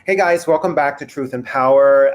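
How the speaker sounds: usual speaking voice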